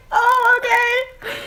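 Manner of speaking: very high, drawn-out squeak; high-pitched acted wounded acceptance; mock hurt. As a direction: squeak it slowly as if crushed, exaggerated. Acts out reaction to insult.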